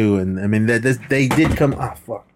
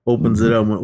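normal talking voice

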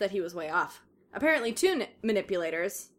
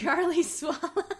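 high, slow, dissolving into real laughs; helpless giggles swallowing the words; breaking into laughter; 8 semitones higher, slower than usual. Real, not acted. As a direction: lose it laughing mid-line. Reader cracks up at the line.

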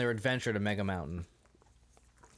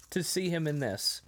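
normal talking voice

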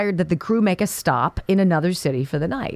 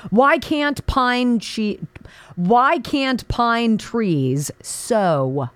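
slow drawn-out setup; 3 semitones higher, much slower than usual. Real, not acted.

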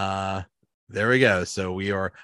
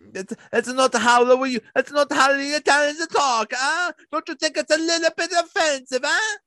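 very high, indignant mock-Italian voice; cartoonish Italian caricature; over-the-top Italian accent. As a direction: protest in a hammy Italian accent, pitched way up. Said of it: "the over-the-top Italian accent".

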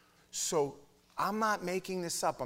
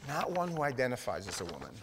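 ordinary talk

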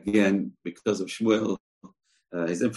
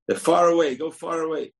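calling out; 10 semitones higher, 4 dB louder than usual. Acted. Signal shouted to arrow boy.

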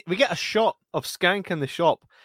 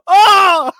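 high loud startled yell; pained yell; 12 dB louder than usual. Retold or imitated. Host imitating the man run over, a loud pained yell. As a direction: cry out as if struck. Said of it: "He's like".

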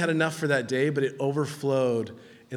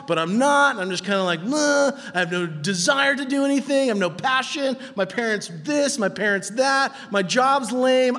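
higher, louder self-pitying whine; whiny complaint; 8 semitones higher, 5 dB louder than usual. Retold or imitated. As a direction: moan like someone stuck and unmotivated. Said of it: "Anyone ever felt that in your life?".

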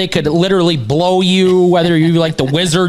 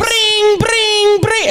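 high-pitched mock telephone ring; imitated phone ring; 13 semitones higher, much slower than usual. Co-host playing the hater hotline's phone, imitated ringing. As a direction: sing out a high, drawn ringtone.

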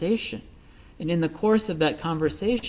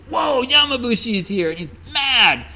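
startled alarm; 10 semitones higher, 5 dB louder than usual. Retold or imitated. People scatter in fear.

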